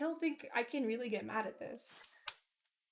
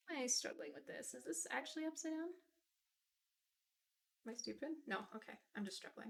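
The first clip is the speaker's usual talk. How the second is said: muttering to self; 10 dB quieter than usual. Real, not acted. Fiddling with cable, talking to self.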